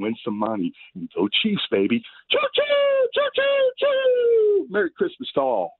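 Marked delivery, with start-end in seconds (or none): 2.3-4.7 shrill falsetto choo-choo cry; high train whistle chant. Real, not acted.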